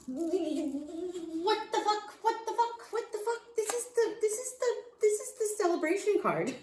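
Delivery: very high-pitched, giddy disbelief; astonished squeal. Real, not acted. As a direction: squeal it in repeated bursts of shock. Astonished at the card she draws.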